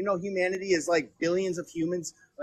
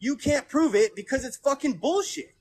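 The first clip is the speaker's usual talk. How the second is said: higher, slow and emphatic; angry insistence; 7 semitones higher than usual. Real, not acted. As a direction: hammer each word in anger. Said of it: "I'm pissed".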